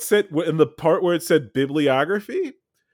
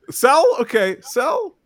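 giggling through the words; laughing; 8 semitones higher than usual. Real, not acted. Friend teasing Sal about his schoolwork, laughing.

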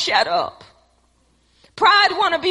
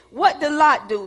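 normal talking voice

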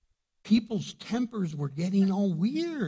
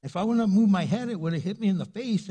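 normal talking voice

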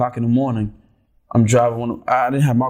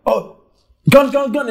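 high, louder repeated police cry; mock alarmed yell; 9 semitones higher, 4 dB louder than usual. Retelling police beatings on video, mock yell. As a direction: call it out like an alarmed cop. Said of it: "they yell out".

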